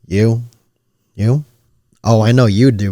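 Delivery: normal talking voice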